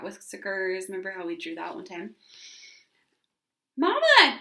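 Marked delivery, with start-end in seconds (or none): 3.8-4.4 high, loud joyful call of Mama; a girl calling her mother in delight; joyful cry. A narrator's reading. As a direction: shout for mother full of joy. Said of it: "cried Ramona, filled with joy".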